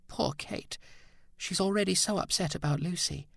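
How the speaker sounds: a woman on the edge of tears; tearful. Acted. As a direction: near tears, breathy and hushed. Sculptress weeps over Kate.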